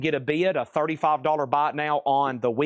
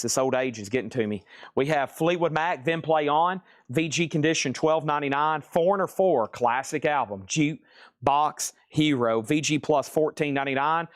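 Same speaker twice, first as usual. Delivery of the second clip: ordinary talk, nothing marked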